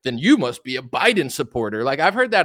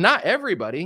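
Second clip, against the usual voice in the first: ordinary talk, nothing marked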